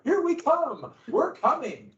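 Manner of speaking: high, sing-song redcoat taunt; mock British soldiers. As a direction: tease it like cocky soldiers marching in. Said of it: "So it'd be like".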